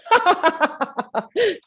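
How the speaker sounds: high, loud burst of cackling; villainous high laugh; evil laughter. Real, not acted. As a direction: cackle wickedly in a rapid string. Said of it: "that was an evil laughter".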